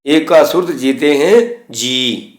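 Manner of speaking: slow, with "ਜੀ" held a little long; "ਜੀ" drawn out slightly, the sihari vowel lengthened. Shown as a demonstration. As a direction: stretch the "ਜੀ" at the end, as the teacher shows. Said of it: "ਥੋੜ੍ਹਾ ਜਿਹਾ ਲੰਬੇ ਕਰਕੇ ਬੋਲਣਾ ਹੈ ਜੀ".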